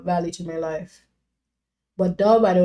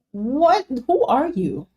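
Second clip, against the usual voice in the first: mock surprise; 5 semitones higher, slower than usual.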